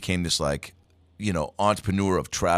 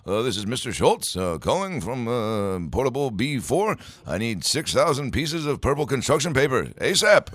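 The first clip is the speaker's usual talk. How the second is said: fake Mister Schultz phone call; 7 semitones higher, 3 dB louder than usual. Student prank-calls the office as Mister Schultz, rising higher. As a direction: voice rising higher and higher toward a loud asap. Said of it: "call the office as him".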